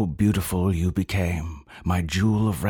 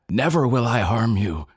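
higher and louder, bellowed; 5 semitones higher, 4 dB louder than usual. A narrator's reading. Young one bellowing a vow against the father's plan. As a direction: roar the vow out. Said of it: "I bellow".

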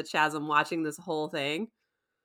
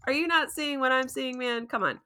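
higher, urgent pleading at Steven; mock exasperation; 7 semitones higher than usual. Host retelling her alarm at Steven, higher and urgent. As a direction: snap him out of it. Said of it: "Meanwhile, I'm like".

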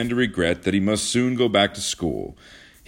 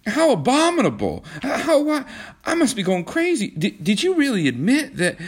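high, louder, aghast; horrified outburst; 5 dB louder than usual. A narrator's reading. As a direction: cry out in disbelief. John horrified at revelation.

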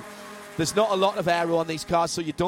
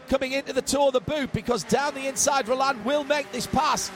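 raised, lively live race call; motorsport commentator; 4 semitones higher than usual. Acted. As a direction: call the overtakes as they happen.

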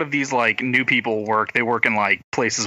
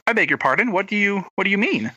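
higher-pitched mock indignation; taken aback; 7 semitones higher than usual. She answers the colleague questioning her accent, high affronted retelling. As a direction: act taken aback, voice lifted. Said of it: "I'm like".

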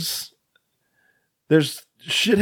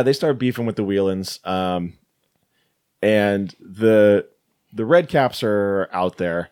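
ordinary talk, nothing marked

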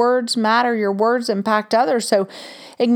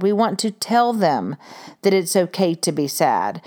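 ordinary talk, nothing marked

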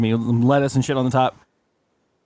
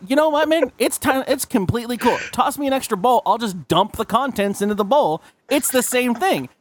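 high mock reassurance; 8 semitones higher than usual. S1 voices himself reassuring.

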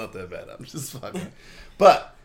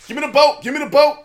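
shouting; 12 dB louder, faster than usual. Acted. Playful demanding shout.

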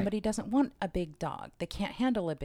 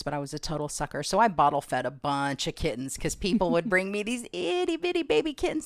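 ordinary talk, nothing marked

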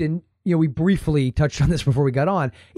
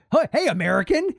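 mock foreign call-out; 7 semitones higher than usual. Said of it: "they probably don't say it like that".

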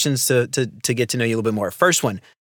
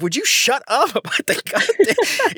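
annoyed; 12 semitones higher than usual. Retold or imitated. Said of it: "she's like".

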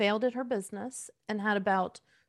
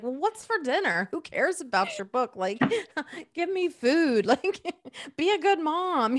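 put-on higher, louder bratty kid voice through laughter; mock demanding son; 6 semitones higher, 4 dB louder than usual. Mother imitates her son during the book launch: laughing mock kid whine. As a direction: play the hungry son, laughing as you do it. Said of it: "my son's like".

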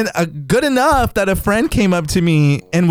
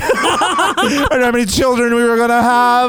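fake-crying lament breaking into laughter; hammed-up tearful voice, pitched up; mock emotional; 6 semitones higher than usual. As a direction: wail the lost wedding plans, laughing.

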